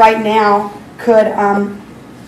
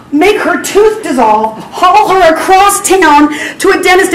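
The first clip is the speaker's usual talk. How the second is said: higher and louder, raw and overwhelmed; weeping prayer; 5 semitones higher, 3 dB louder than usual. Retold or imitated. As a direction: pour it out to God through tears. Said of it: "and I just bawled".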